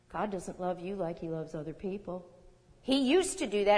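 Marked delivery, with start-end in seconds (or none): 0.1-2.3 soft, low insinuating voice; hushed devil's lie. Retold or imitated. Preacher voicing the devil's lie, softly. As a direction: murmur the lie close to the ear.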